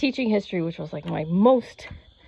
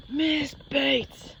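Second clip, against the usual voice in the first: usual speaking voice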